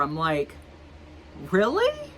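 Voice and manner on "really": squeaky high disbelieving really; shocked really. Retold or imitated. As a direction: leap way up in disbelief. Repeats disbelief to coworker.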